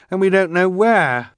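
high, loud distressed voice. A narrator's reading. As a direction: press the worry at the end. Violet distressed.

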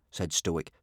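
plain reading voice

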